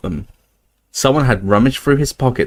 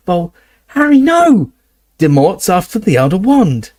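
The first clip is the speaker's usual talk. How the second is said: high, loud exasperated objection; exasperated protest; 8 semitones higher, 4 dB louder than usual. Acted. Hermione arguing with Harry, high and exasperated. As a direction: cut him off, exasperated.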